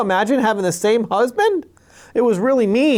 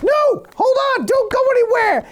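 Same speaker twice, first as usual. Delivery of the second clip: much higher, desperate plea; pleading Jacob. Retold or imitated. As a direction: beg him not to leave. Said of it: "And Jacob's like".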